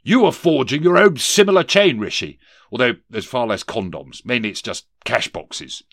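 loud warning that eases into an aside; blustering ghost. Acted. Ghost warns Scrooge of his chain, loud then offhand. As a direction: start high and booming, then settle.

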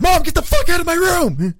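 yelled high and loud at mom; furious yell. Retold or imitated. Son screaming at his mom to get out. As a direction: blow up at her, full volume.

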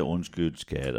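low, very soft murmur; sheepish apology. Retold or imitated. Parent to child after outburst, quiet apology. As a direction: imitate a contrite parent saying sorry. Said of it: "så kommer de og siger".

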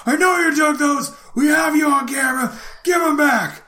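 high put-on shout; mock yell. Clerk insisting the thief was caught on camera, high mock shout. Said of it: "I'm like".

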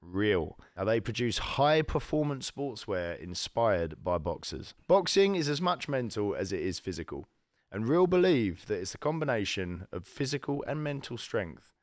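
measured, carefully enunciated presenter delivery; sponsor ad read. Acted. Host thanking the sportswear sponsor, polished ad read. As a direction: read out a podcast sponsor message to listeners.

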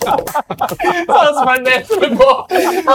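high, drawn out through real laughing; helpless laughter. Real, not acted. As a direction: squeeze the words out while cracking up.

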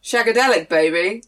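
loud groovy Austin Powers shout; Austin Powers impression. Teasing Mike Myers with a loud groovy catchphrase. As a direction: ham up the swinging spy catchphrase. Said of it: "I'd just piss him off by being like".

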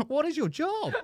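very high, loud squeal through laughter; incredulous high-pitched comic outburst; mock outrage. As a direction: shriek the question in laughing disbelief. Mock outrage at barista's question.

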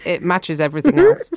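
normal talking voice

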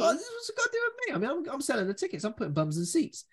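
very high, fast squeaky protest; mock protesting booker. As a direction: squeal it out fast like an outraged promoter.

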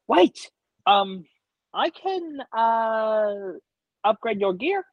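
high, squeaky little kobold. Acted. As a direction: pitch way up like a small eager kobold. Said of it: "the little kobold".

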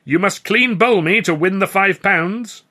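high, loud, slow proclamation; showman clown. A narrator's reading. Clown states the prize rules to the bowler, proclaiming. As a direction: announce the challenge to the big top.

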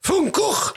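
Van Gogh as very high, throaty "foon-KOKH"; hammed-up Van Gogh with a scraping final kh; mock mispronunciation. Guest repeating people's version for the host, mock mispronunciation. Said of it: "I've heard people say".

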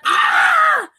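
high, loud retold scream; hammed-up high yell; mock scream. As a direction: scream as the character being hit. Retold beating, character cries out.

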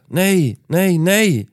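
high, loud panicked screams of no; screaming no. Acted. Sofia screaming at the attacker in the street, high panicked shout. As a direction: shriek it as she watches him get hit. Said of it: "hörde samtidigt Sofia skrika".

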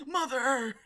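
high anguished cry; dying soldier voice. Pretend. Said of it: "Dying soldier voice".

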